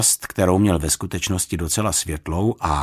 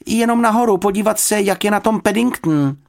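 hurried excuse; 12 semitones higher, 5 dB louder than usual. Acted. Girl hurrying upstairs, calling back.

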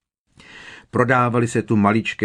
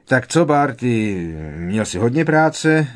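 hearty, loud, higher-pitched greeting; jovial; 4 semitones higher, 5 dB louder than usual. A narrator's reading. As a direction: ask a colleague with breezy good cheer. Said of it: "zeptal se Pitloun bodře". (bodře)

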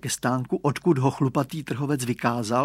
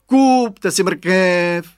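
loud, high, very drawn-out street-seller call; market-vendor cry; 5 semitones higher, 8 dB louder, much slower than usual. A narrator's reading. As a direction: hawk it across the market, stretching the vowels. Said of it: "volají". (táhlé vyvolávání trhovce)

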